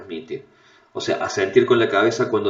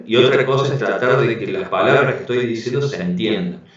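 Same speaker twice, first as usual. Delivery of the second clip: ordinary talk, nothing marked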